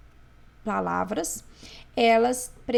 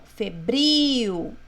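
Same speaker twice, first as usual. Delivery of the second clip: Febril with a long, loud final BRIL; stress on BRIL; 6 dB louder than usual. Shown as a demonstration. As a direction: punch and stretch BRIL in Febril. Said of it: "Acento mais forte é o bril". (Febril, carregando bem na última sílaba, bril)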